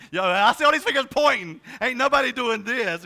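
loud, high mock call-out at the crowd; joking shout. Pastor jokingly calls out the congregation, loud and high. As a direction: bellow it out as a playful accusation.

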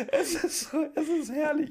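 high, laughing and near tears. Real, not acted. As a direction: barely get it out through laughter. Still laughing helplessly. (lachend, fast weinend)

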